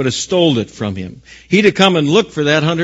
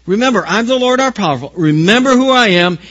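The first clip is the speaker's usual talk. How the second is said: raised, insistent higher-pitched appeal; God urging; 6 semitones higher, 4 dB louder than usual. Acted. Preacher voicing God reassuring people facing a hard place, insistent urging. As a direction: urge them on with rising intensity. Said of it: "And so He says".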